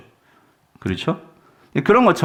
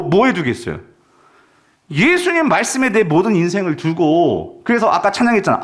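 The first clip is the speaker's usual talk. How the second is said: usual speaking voice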